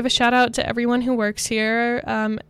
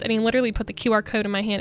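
usual speaking voice

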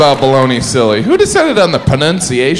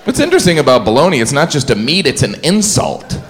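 normal talking voice

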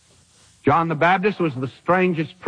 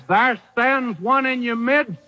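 fervent proclamation; 7 semitones higher than usual. Retold or imitated. John the Baptist telling the crowd one stands among them, fervent. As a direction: announce him with awe. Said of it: "John says".

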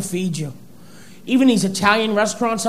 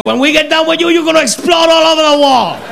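excited waiters; 6 semitones higher, 4 dB louder than usual. Retold or imitated. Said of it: "They said".

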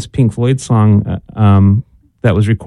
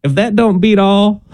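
put-on higher, playful Southern accent; 7 semitones higher than usual. Host reciting folksy sayings in a mock Southern accent. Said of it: "Why is your voice getting southern sounding?".

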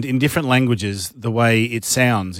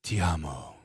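soft, low, romantic ti amo; tender Italian; 8 semitones lower, 12 dB quieter than usual. Shown as a demonstration. Host contrasting pretty languages with German, tenderly. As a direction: caress the words quietly.

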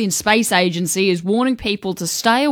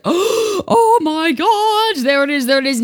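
excited; 9 semitones higher, slower than usual. Retold or imitated. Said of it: "I was just like".

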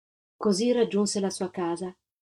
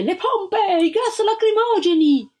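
much higher, urgent shouted order; 12 semitones higher, 4 dB louder, slower than usual. A narrator's reading. An officer shouts for hoses and tear gas, urgent. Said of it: "Si udì una voce".

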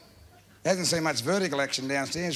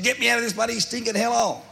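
put-on angry old uncle; 9 semitones higher, 4 dB louder than usual.

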